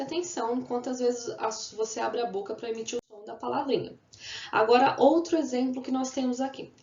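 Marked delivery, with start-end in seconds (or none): none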